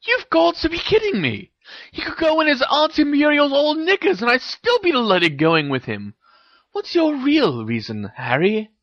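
put-on high, exasperated girl's voice; incredulous Hermione. A narrator's reading. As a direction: press a friend in a very high voice. Hermione keeps challenging Harry.

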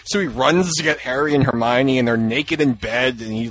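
normal talking voice

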